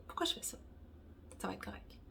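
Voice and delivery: whispered self-talk. Real, not acted. Fiddling with the book for viewers, whispered aside. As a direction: mutter it under your breath in a whisper.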